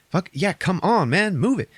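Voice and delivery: put-on hurrying the kid along; hammed-up gung-ho shout; pushy urging. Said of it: "And he's like".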